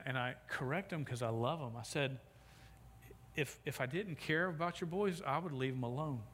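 ordinary delivery

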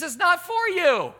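high, drawn-out correction; playful high-pitched rebuke; imitated indignant wife. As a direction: set him straight in a high mock-wife voice. Wife corrects husband, retold.

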